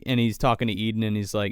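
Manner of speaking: normal talking voice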